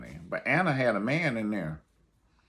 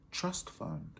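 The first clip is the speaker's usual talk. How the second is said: much softer echo of trust fund; 7 semitones higher, 13 dB quieter than usual. Acted. Vlogger echoing the class chant, soft murmur. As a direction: let it fade to a murmur. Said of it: "Say it with me".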